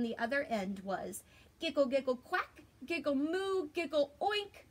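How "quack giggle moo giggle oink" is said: ordinary delivery, nothing marked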